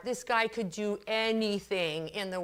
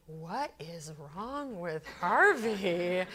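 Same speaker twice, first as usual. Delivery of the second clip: slow, drawn-out puzzled question; baffled onlookers; 3 semitones lower, much slower than usual. Retold or imitated. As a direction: imitate coworkers marvelling at the change. Puzzled coworkers, retold.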